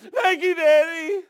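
put-on high, loud little-girl wail; imitated crying child. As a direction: cry it out like a hurting toddler to Daddy. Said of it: "she'd cry, and she'd go".

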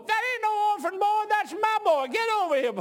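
high, loud imitated scolding yell; outraged mother. Mother exposes her fake-orphan son, high angry yelling.

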